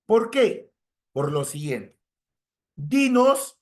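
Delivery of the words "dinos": "Dinos" punched high and loud; stress on Dinos. Shown as a demonstration. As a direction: hit the word "Dinos" hard, like a command. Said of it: "como que el verbo está en imperativo".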